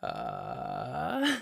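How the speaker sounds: slow low creaky fry; vocal fry. Shown as a demonstration. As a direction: drag it out in fry. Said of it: "that's a thing you can do".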